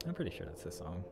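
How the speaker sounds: usual speaking voice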